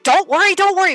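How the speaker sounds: dismissive don't worry. Retold or imitated. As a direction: toss off "Don't worry, don't worry" quickly and carelessly. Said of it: "not like".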